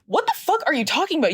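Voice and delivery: fast, high-pitched disbelief; high, rushed rant at an idiot; incredulous outburst. Retold or imitated. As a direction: rattle it off in exasperation. Ranting at the microwave interrupter.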